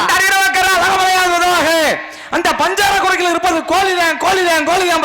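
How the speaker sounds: ringing stage vow; theatrical oath. Acted. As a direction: swear it to the heavens, drawing the words out. Minister repeats the formal oath.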